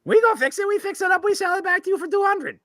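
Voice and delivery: very high squeaky goblin voice; haggling fire goblin. Acted. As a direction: pitch the deal like a tiny imp. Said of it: "these guys are little fire goblins".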